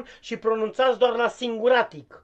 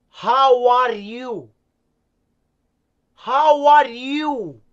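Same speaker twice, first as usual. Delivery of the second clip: slow drill; 2 semitones higher, 6 dB louder, much slower than usual. Acted. Teacher drilling phrase slowly.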